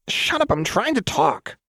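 very high, irritated snap; irritated Jinx. A narrator's reading. Jinx telling off Fishbones, high and irritated. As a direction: snap in a squeaky-high character voice.

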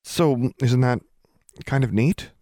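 falter, unsure of the reaction. A narrator's reading. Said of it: "Alexandra faltered".

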